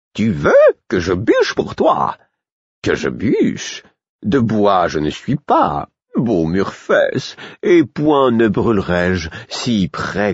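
slow, swooping jester's voice, leaping high; mocking fool. Fool mocking Murfess and calling the guards, theatrical. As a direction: drag out the mockery, then call out loudly.

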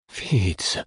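hoarse cry of a name, falling; rough-voiced exclamation. A narrator's reading. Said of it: "mais la voix rauque m'appartenait".

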